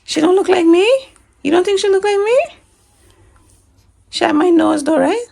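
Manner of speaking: playful indignation; mock offended. Real, not acted. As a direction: pretend to be hurt. Mock offended at daughter's answer.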